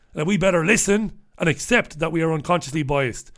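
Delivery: ordinary talk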